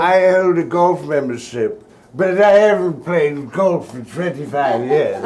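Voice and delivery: put-on slow, lofty old-lady voice; hoity-toity grandma. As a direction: play the posh grandmother, unhurried. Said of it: "kind of a hoity-toity".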